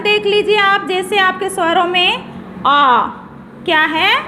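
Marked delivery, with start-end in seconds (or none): 2.7-3.1 आ held as a long "aa"; long vowel. Shown as a demonstration. Teacher giving an example of a long vowel, long vowel. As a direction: draw out आ for double length. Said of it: "ह्रस्व स्वर से दुगना समय लगता है".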